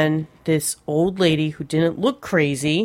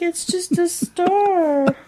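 imitating her child; 10 semitones higher, much slower than usual.

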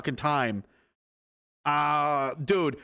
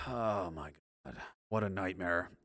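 low, heavy sigh of dismay; weary dismay; 9 semitones lower, 10 dB quieter than usual. Real, not acted. Reacting to grim Taliban news, weary dismay. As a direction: sigh it out, much lower and softer.

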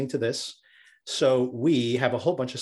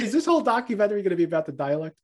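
higher-pitched, hurried incredulous question; mock exasperation; 8 semitones higher, much faster than usual. Host reacting to endless dialect talk, mock exasperated. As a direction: rattle it off in amused disbelief. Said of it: "I'm like".

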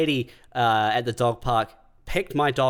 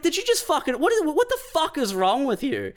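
much higher incredulous yell; shocked outrage; 12 semitones higher than usual. Retold or imitated. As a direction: scream it in disbelief. Retold outburst at stranger.

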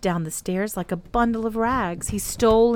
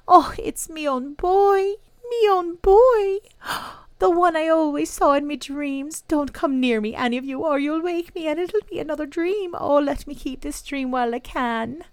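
voice full of tears; 10 semitones higher, 4 dB louder than usual. A narrator's reading. Mother embracing her own returned boy, voice full of tears. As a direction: wail it high, overcome with tearful joy. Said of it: "with her voice all full of tears".

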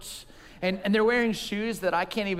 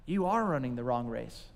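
God's still voice; 9 semitones lower, 5 dB quieter than usual. Pretend. God's Spirit speaks to him.

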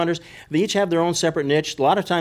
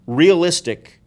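realistic pushed up high and louder; realistic, higher and louder, slowly; stress on realistic; 4 semitones higher, 5 dB louder, slower than usual. Shown as a demonstration. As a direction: lean hard on realistic. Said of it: "emphasis on the word realistic".

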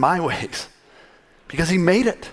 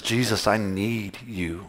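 humble plea; 6 semitones lower, 7 dB quieter, slower than usual. Acted. Humble prayer quoted.

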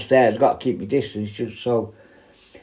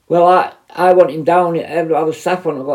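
impatient manager; 5 semitones higher, 4 dB louder than usual. Retold or imitated. Manager demands him down.

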